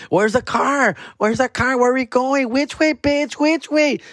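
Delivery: put-on party girls demanding the car; rowdy drunk girls. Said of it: "se hacían que".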